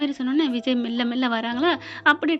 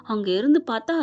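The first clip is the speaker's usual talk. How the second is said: usual speaking voice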